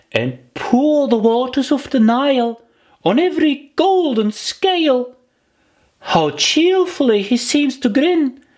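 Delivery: put-on a little girl stumbling through a memorised poem; high young girl's recitation voice; Alice reciting. A narrator's reading.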